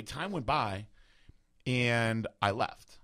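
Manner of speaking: usual speaking voice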